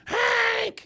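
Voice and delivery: piercing high loud shout; shrill desperate cry; screaming. Retold or imitated. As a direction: call his name like a shriek. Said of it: "She just screaming for him".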